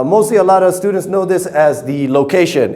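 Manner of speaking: normal talking voice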